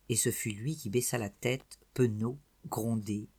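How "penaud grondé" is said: plain reading voice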